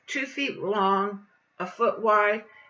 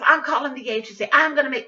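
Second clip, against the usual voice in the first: indignant threat; 5 semitones higher, 7 dB louder, much faster than usual. Retold or imitated. Acting out aide's threat.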